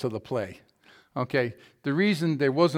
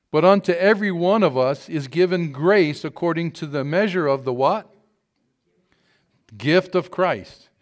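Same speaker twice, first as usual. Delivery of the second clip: loud, declaiming scripture read; 4 dB louder than usual. Acted. Pastor reading Ephesians to the congregation, loud declamation. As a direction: proclaim the verses to the congregation.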